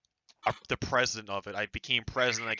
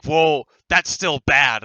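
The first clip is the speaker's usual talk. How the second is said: drawn-out, louder imitating scold; mock him scolding slowly and louder; 3 semitones higher, 8 dB louder, much slower than usual. Friend scolded over a fourteen-year-old girl, slow, louder mock scold. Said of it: "He's like".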